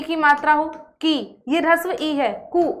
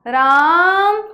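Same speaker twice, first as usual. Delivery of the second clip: louder drawn-out call of राम like a mother; 5 dB louder, much slower than usual. Retold or imitated. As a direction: call राम from afar like mom. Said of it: "इस तरह से आपको आवाज लगाती है mummy". (Mummy की तरह ज़ोर से पुकार)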